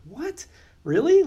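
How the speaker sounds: very high, incredulous questions; mock disbelief. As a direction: squeak out your astonishment. Retold disbelief at the news.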